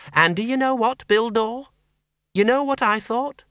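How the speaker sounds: put-on a blunt old farm woman talking plainly; Miss Flitworth. A narrator's reading.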